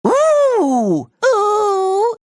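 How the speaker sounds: long, high-pitched ooh; high cartoon oohs. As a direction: coo it high and drawn out. Wordless reaction sounds.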